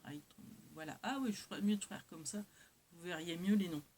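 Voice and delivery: ordinary talk, nothing marked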